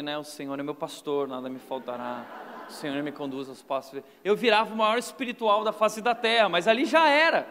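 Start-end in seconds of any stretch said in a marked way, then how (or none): 0.2-4.0 lower, softer pious recitation; mock pious recital. Boy reciting a psalm beside his angry father, hushed. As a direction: recite the psalm like a scared saint. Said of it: "começava a recitar do lado dele".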